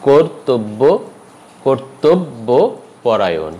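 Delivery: কর্তব্য slowly as "kor-tob-bo"; কর্তব্যপরায়ণ very slow with rounded "o"; syllable-by-syllable demo. Shown as a demonstration. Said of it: "উচ্চারণটি হবে".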